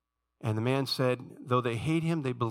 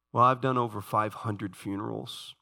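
ordinary talk

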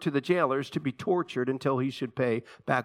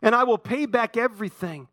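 mildly pleading, raised pitch; understated beg for more time; begging; 8 semitones higher than usual. A narrator's reading. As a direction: plead with a touch of urgency. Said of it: "he begged him".

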